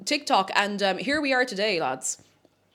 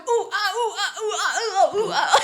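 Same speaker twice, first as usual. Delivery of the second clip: higher laughing ooh-ah; mock monkey hoots; 9 semitones higher, 5 dB louder than usual. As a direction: hoot like a monkey, giggling. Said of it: "It sounds like I'm just going".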